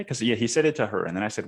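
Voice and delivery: normal talking voice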